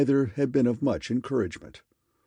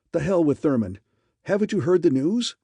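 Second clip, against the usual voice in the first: high, loud impatient outburst; excited; 6 semitones higher, 5 dB louder than usual. A narrator's reading. Raymond cutting Harker off on the phone, loud excited. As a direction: brush him off, breathless with news. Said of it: "this excited".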